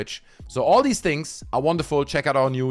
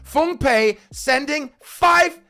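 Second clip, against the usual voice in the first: high, loud, slow hype call; hype shout; 14 semitones higher, 4 dB louder, slower than usual. Real, not acted. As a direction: celebrate the donation out loud.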